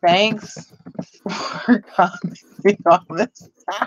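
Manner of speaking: reading the typed words through a laugh; slow, amused read-back. Real, not acted. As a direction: giggle while reading the comment back. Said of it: "now I'm spell checking myself".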